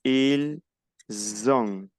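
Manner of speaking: "ils", pause, then "ont" begun with a buzzed Z; Z liaison. Shown as a demonstration. Teacher splits the avoir form, "ont" starting on Z. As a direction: separate il and zon, buzzing the Z. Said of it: "la pronunciación sería".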